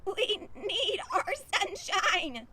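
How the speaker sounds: high, slow bleating plea; sheep shouting. Acted. Sheep complaining to the rooster, high slow wail. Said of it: "shouted the sheep".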